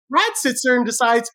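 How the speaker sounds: ordinary delivery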